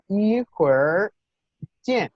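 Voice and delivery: 一会儿 见 slowly, curled English-like r on 会儿, pause before 见; model pronunciation. Shown as a demonstration. Teacher modelling for the student to repeat, slow r-coloured Mandarin. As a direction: spell out 一会儿 见 high and slow. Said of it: "Entonces aquí sería".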